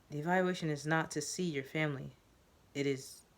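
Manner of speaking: ordinary delivery, nothing marked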